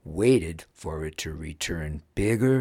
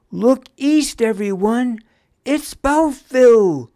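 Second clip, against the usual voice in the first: loud, high lookout's cry; excited shout; 12 dB louder than usual. A narrator's reading. Pun on lookout spots land and alerts everyone, shouting. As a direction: call it out to everyone aboard, last words stretched. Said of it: "she shouted".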